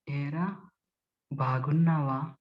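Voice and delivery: low, drawn-out, hushed question; very slow and gentle. Shown as a demonstration. Speech trainer demonstrates a greeting, extremely slow and gentle. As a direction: stretch it out softly, with a pause between words. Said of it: "అతి నెమ్మదిగా".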